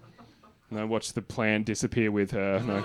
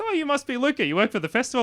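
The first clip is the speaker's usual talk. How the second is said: friendly partner; 11 semitones higher, 4 dB louder than usual. Retold or imitated. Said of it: "She goes".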